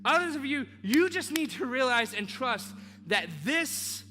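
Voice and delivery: preaching at full force. Acted. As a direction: drive every word home from the pulpit.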